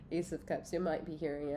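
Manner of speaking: ordinary delivery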